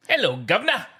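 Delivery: hammed-up English governor greeting; English accent. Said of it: "Give me an English accent".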